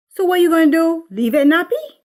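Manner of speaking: loud, high scornful question; scornful mother. Acted. Mother scoffing at her daughter's natural hair, loud and scornful. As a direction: sneer at the idea.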